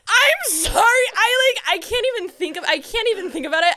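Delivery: high, loud squeal of disbelief; really gushing, voice shooting up; overwhelmed. Real, not acted. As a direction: squeal it, too giddy to think. Overwhelmed reliving the big moment.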